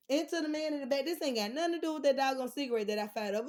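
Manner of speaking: loud and high, calling out the judgers; riled-up call-out. Real, not acted. Calling out the man in the back, loud and riled up.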